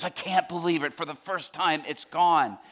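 normal talking voice

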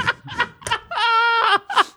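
high, long burst of laughing; real laughter. Real, not acted. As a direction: crack up helplessly. Laughing at the chat's insult.

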